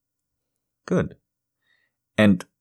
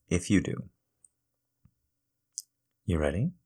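soothing; 2 semitones lower, 7 dB quieter than usual. Acted. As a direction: ease them in gently. Soothing before injection.

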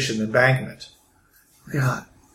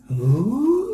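spooky ooh; 9 semitones higher than usual. Acted. GM voices NPC reaction.

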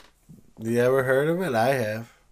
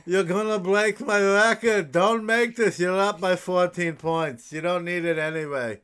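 put-on higher coaxing old man voice; 5 semitones higher than usual.

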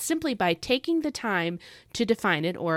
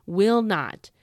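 Will not, both words hit louder with extra oomph; emphatic will not; 5 dB louder than usual. Shown as a demonstration. Repeating the promise to listeners, Will not punched loud. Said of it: "put some extra oomph".

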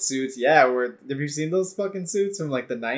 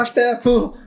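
much higher, louder mock outrage; loud retort; 9 semitones higher, 5 dB louder than usual. Real, not acted. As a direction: belt the retort out high. Repeats his retort much louder.

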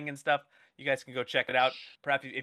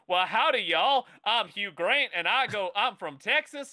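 loud, high mock Texas drawl; hammed-up howdy-y'all Southern accent; Hugh Grant doing Texan; 5 semitones higher, 4 dB louder than usual. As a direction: play a Brit badly faking a Texan accent. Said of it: "like Hugh Grant coming out and going".

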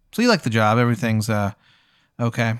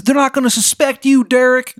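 high, loud, hammed-up Maryland accent; cartoonish Maryland caller, high and pushy; bad Maryland accent; 12 semitones higher, 4 dB louder than usual. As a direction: overdo a lousy Baltimore accent, pitched way up. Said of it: "Maryland accent is so bad".

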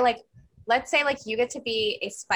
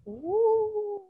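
high wailing woo; banshee shriek; 12 semitones higher than usual. Shown as a demonstration. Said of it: "the shriek would be like".

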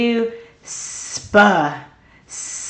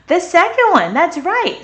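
excited praise; 9 semitones higher, 4 dB louder than usual. Real, not acted. Teacher cheering the right answer.

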